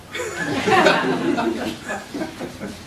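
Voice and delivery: high, breathy laughing. Real, not acted. Speaker reading to the guests, laughing at the car story. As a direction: laugh at the punchline.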